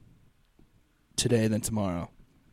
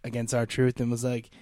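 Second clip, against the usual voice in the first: ordinary delivery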